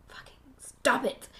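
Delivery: harsh whisper to herself; whispered self-scolding. Real, not acted. Scolding herself for repeating anyways, whispered. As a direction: hiss it at yourself, annoyed.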